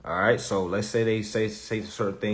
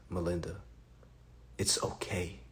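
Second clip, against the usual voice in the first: soft soothing; 5 semitones lower, 13 dB quieter than usual. Retold or imitated. Soothing the dealer softly.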